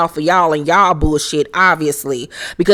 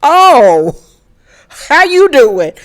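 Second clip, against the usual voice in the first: higher, louder gushing hello; mock fake greeting; 8 semitones higher, 7 dB louder, much slower than usual. Vlogger mocking a friendly hello to Erica, higher and louder. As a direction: gush it out like a phony friend. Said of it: "I'm like".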